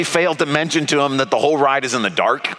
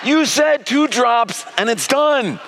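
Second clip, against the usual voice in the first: high, drawn-out mock accusation; slow, raised-pitch protest imitating an indignant kid; accusing child; 8 semitones higher, slower than usual. Son accusing dad on the ride, mock accusation. Acts out son's accusing look.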